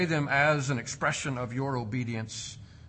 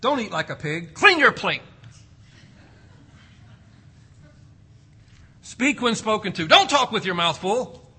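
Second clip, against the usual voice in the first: a hint of a nagging parent, getting louder; mock parent demands; 5 semitones higher, 5 dB louder than usual. Parents scolding kids at the meal table, rising mock nag. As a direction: rattle off table rules like a parent. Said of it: "we demand".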